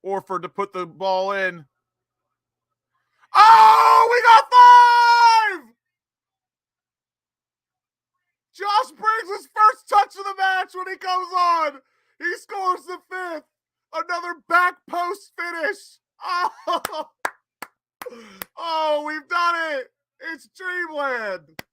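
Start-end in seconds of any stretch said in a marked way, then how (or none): 3.3-11.8 screaming far higher and louder with joy; real goal scream, voice cracking; ecstatic scream. Real, not acted. Fan celebrates a goal. 12.2-17.1 high, tearful excitement; real tearful elation; overjoyed, near tears. Real, not acted. Overjoyed fan after goal. 18.6-21.6 high, crying with joy; real tears of joy; tearful joy. Real, not acted. Fan overwhelmed by goal.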